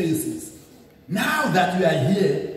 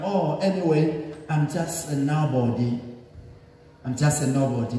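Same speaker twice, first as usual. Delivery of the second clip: deflated self-pity; 5 semitones lower, 5 dB quieter than usual. Retold or imitated. A believer voicing self-doubt.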